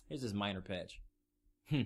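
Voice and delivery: low, offhand delivery; dismissive company. Acted. Game company handing players a small patch, dismissive. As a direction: toss it off with cool indifference.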